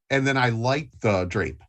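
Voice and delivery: normal talking voice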